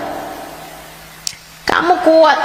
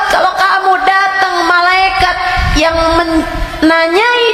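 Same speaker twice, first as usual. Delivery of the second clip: fiery preaching; 11 semitones higher than usual. Acted. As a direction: thunder the warning of the grave. Preaching about grave punishment. (ceramah berapi-api dengan suara tinggi dan keras)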